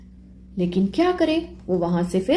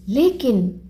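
usual speaking voice

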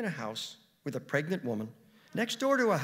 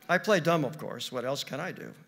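usual speaking voice

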